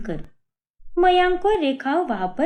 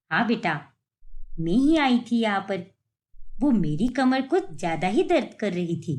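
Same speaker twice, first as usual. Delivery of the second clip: put-on lower, older mother-in-law voice; mother-in-law asking her daughter-in-law about the market, in an older, lower voice; 5 semitones lower than usual. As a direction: speak as the puzzled elderly mother-in-law.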